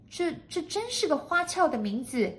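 high-pitched maid's voice; high, impressed maid; marvelling maid. A narrator's reading. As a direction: stammer in high wonder.

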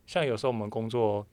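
normal talking voice

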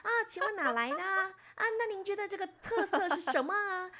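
high, far-off reporter voice; imitating the reporter. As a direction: mimic a mainland TV reporter asking. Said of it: "那我要学一下你们".

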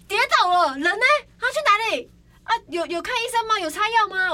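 loud, high, alarmed questions; shocked questioning. Retold or imitated. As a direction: fire off panicked questions in disbelief. Retold shocked questions about a fall.

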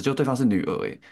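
usual speaking voice

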